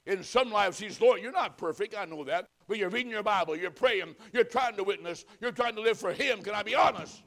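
loud, high, urgent pulpit delivery; fervent warning sermon, punching key words; full-force preaching. Real, not acted. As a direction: drive each phrase home to the congregation.

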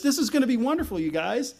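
high, sing-out fake cheer; mock upbeat. Samuel opening a coronation service, mock upbeat. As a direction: sell it as ironically wonderful news.

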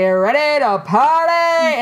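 high, loud, drawn-out party call; Bridesmaids impression. Storyteller mimics the airplane scene, high drawn-out party cry. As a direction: stretch it out, slurred movie-character shout. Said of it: "I looked like in Bridesmaids, the one she's like".

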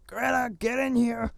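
high, loud yell from afar; imitated shout. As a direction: yell it out as an angry order. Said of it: "and he shouts".

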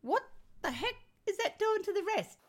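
high-pitched, emphatic indignation; exasperated. Real, not acted. Educator protesting what pushed-down schoolwork does to children, exasperated. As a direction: land each word like an outraged question.

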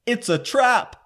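high, loud alarm cry; mock urgent warning shout; Akbar. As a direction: call out the trap in sudden alarm.

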